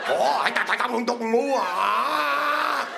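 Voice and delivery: hammed-up mock Chinese running out of air; breathless mock Chinese. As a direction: fake Chinese syllables running out of air, ending in a shout. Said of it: "until there's no more air in their body".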